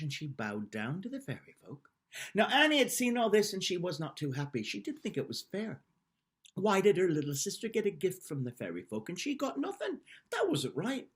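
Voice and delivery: ordinary delivery, nothing marked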